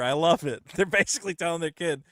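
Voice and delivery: usual speaking voice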